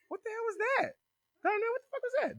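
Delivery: very high-pitched indignant voice; shocked imitation. Whitley asks Darnell about the slam, high shocked imitation. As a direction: imitate her startled demand, voice way up.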